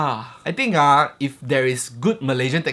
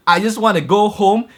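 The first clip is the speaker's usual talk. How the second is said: retold yell; 6 semitones higher, 6 dB louder than usual. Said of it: "I am yelling".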